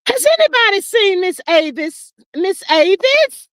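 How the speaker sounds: high-pitched call for someone missing. Real, not acted. Host asks whether anybody has seen Miss Avis, calling out. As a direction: call out for her, stretching the name.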